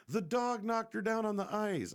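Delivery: high, loud mock explanation; loud protest. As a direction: keep pleading innocence, voice up. Continuing the protest.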